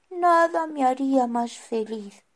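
a little penguin longing to fly; high bird voice. Acted. Carlota answers.

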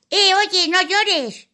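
high loud impatient fairy; exasperated fairy. Acted. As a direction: lose patience with the crying. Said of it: "los lloriqueos la sacaban de sus casillas".

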